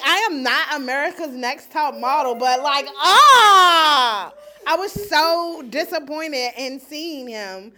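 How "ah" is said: louder, higher frustrated yell of laughter; exasperated shout. Real, not acted. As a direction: let out a comic groan. Dismay after seeing his hair.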